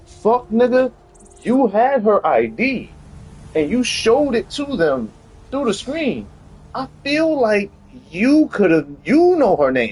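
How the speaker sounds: high-pitched incredulous rant; exasperated. Retold or imitated. Telling off the TikToker in his head, exasperated.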